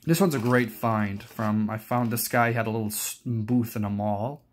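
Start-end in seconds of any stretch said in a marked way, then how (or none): none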